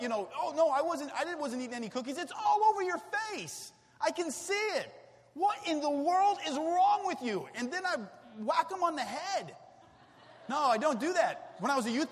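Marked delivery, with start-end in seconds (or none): 0.4-2.2 put-on high, fast fibbing child; guilty child. Daughter caught with chocolate face, high fast denial. Child denying eating cookies. 2.2-7.5 put-on high, loud scolding of a child; upset parent. Dad catching his daughter with cookies, loud upset scolding. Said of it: "I kinda get a little upset".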